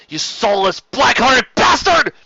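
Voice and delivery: slow, high, loud bellowed insult; raging captive screaming at enemy; furious scream. Acted. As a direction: scream it at him. Sheridan screaming at Sandoval.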